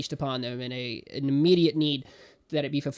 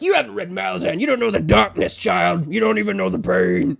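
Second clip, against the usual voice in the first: hammed-up voice acting; 4 semitones higher, 8 dB louder than usual. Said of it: "Your voice acting".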